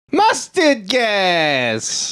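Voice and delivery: very slow, stretched words; drawn-out answer. Acted. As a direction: drag every syllable out.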